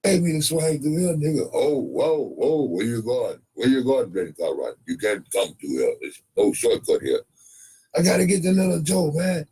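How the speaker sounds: high-pitched hurried rider; comic high-voiced cowboy; high put-on voice. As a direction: keep it high and rushed.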